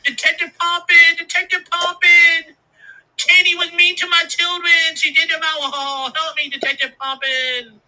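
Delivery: put-on high, loud, slow whiny drunk voice; town drunk. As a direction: wail like a pathetic town drunk. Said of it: "he'd have waddled on down to the police station and going".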